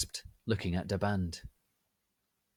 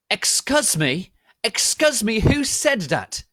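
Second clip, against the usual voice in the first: slow, very loud, high demand; yell; 13 dB louder, slower than usual. A narrator's reading. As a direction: demand an answer angrily. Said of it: "yelled Mister Norris".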